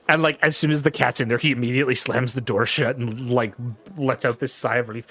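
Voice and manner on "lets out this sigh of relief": ordinary delivery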